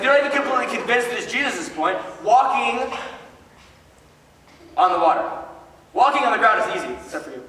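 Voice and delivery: ordinary talk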